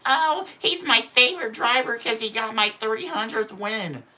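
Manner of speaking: sarcastic impression of a gushing owner; mock team owner. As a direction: parody a proud team owner doting on his driver. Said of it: "Hendrick being like".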